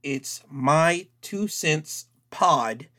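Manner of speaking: slow, spelled-out email address; dictating an email address slowly; slow email dictation. Shown as a demonstration. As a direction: read the address out slowly for listeners. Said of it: "uh, email".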